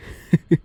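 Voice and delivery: short sinister laugh; a quick gloating laugh from the dark; dark chuckle. Acted. Shadow gloating over trapped victim, sinister chuckle.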